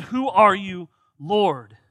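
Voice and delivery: loud, startled cry to the Lord; stunned question. Acted. Saul on the ground asking the voice, loud and startled.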